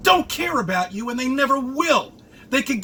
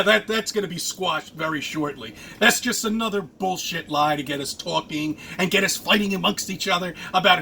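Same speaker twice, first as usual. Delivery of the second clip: usual speaking voice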